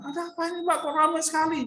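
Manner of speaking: curious question. Acted. Bartimeus asking the crowd.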